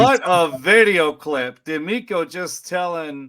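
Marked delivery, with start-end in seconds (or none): 0.0-1.6 loud, drawn-out rave; hyped raving. Real, not acted. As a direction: stretch it out loudly in amazement. Hyped reaction to a video clip.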